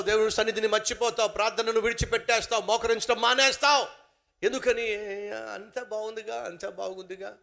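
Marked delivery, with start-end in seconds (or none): 5.3-7.4 complacent. Pretend. Voicing complacent believer.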